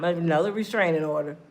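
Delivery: normal talking voice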